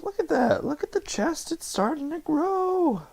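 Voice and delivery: high, excited voice gushing over progress; excited squeal. Real, not acted. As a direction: squeal it out with delight, slowing at the end. Excited about chest growing, high voice.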